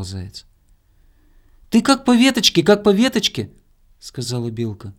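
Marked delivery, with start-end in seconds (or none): 1.7-3.5 high, loud squirrel voice; urging squirrel. A narrator's reading. Squirrel telling hare how to climb, high loud urging. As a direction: coach them along in a high, loud call.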